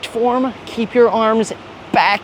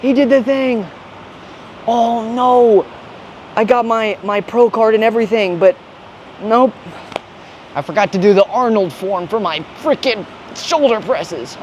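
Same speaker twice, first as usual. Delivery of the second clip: higher, hammed-up dismay; higher mock fan wail; mock future fans; 6 semitones higher than usual. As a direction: overplay the tragic pro. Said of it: "they're gonna be like".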